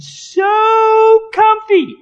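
high, drawn-out squeal of comfort; play-acting luxurious bliss in falsetto; blissful mock delight. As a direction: stretch it out way up high. Playing at enjoying soft underwear.

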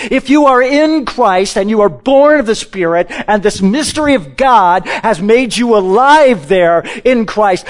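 usual speaking voice

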